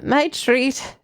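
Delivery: usual speaking voice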